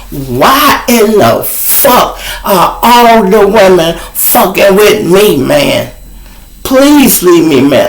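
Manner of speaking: furious high shout, slow and drawn out; snapping. Retold or imitated. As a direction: blow up at the women who keep hitting on him. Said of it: "I'm talking about I snapped".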